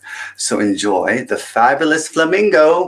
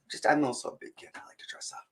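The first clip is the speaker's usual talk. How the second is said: playful whisper; 5 semitones higher, 13 dB quieter, much faster than usual. Real, not acted. Playful confession to kids.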